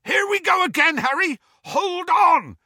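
high, loud yell; yelling Hagrid. Acted. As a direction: yell it over the engine. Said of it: "yelled Hagrid".

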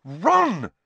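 sharp, high, loud reproof. A narrator's reading. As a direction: cut in with a scolding call. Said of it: "said Hermione sharply".

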